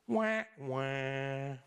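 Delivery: comic "wah, wah" lament; drawn-out mock wail sliding down low; mock crying noise. Preacher mocking Elijah's self-pity, comic wah-wah. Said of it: "I make that noise and we laugh".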